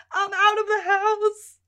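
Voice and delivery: high, excited squeal of joy; thrilled. Retold or imitated. Sheila delighted to escape the house, squealing. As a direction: bubble over with glee. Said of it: "Sheila's thrilled about it".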